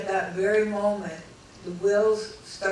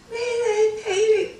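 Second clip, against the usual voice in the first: shrieking mayday; 6 dB louder than usual. Retold or imitated. Panicked radio call again.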